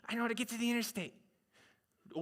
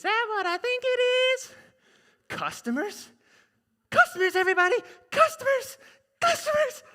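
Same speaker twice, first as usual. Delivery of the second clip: high, loud, excited calls for customers; excited shopkeeper voice; 7 dB louder than usual. Acted. Shopkeeper spotting customers, high excited cries. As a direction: squeal out to the whole town. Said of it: "listen to me do all the dialogue".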